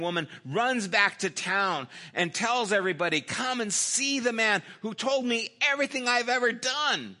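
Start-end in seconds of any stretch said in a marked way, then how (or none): 3.2-7.2 high, ringing retold proclamation; excited announcement. Samaritan woman runs back to tell the town, excitedly. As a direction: call the whole town over, pitched high.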